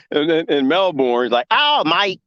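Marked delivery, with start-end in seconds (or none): 1.5-2.2 a mock Australian "Oh, mate," slightly higher; lightly imitating an Aussie, drawing out "mate"; Australian accent. Imitating a Melbourne protester with a light Australian accent. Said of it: "they talk kinda like that".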